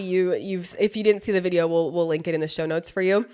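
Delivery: normal talking voice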